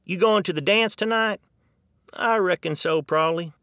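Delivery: high, easy Texan exchange; two locals chatting about a dance; Texan accent, casual; Texan chat. Retold or imitated. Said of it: "just say probably".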